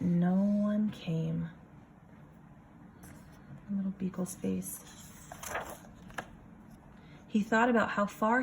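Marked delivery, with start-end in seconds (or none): none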